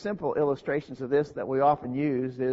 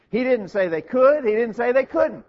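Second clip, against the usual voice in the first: higher, louder, insistent sermon climax; raised-voice preacher hammering out the argument; emphatic preaching; 9 semitones higher, 6 dB louder than usual. Real, not acted. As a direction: drive the point home at full preaching force. Preacher driving the point home.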